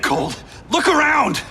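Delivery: exasperated call to a stubborn ally; urgent shout. Acted. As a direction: yell at him to open his eyes. Urging ally amid attack.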